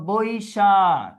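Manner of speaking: তারপরে আসো Boishakh said slowly, Boishakh drawn out; teacher reading out Boishakh slowly; slow dictation. Shown as a demonstration. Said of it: "teacher dictating word".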